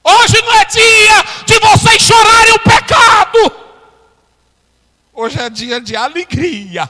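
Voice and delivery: high, loud, slowed shouting; full-force preaching. Acted. Preacher to congregation, shouting the message. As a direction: thunder it over the congregation.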